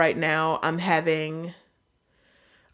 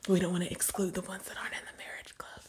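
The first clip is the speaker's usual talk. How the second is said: whispered aside; 11 dB quieter, faster than usual. Real, not acted. Aside about excluding unmarried people.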